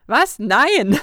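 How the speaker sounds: sudden loud, high cry of no; shocked protest. Acted. Narrator squeals at Lucy grabbing her handbag with the letters, loud and shocked. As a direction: yelp as someone snatches your bag.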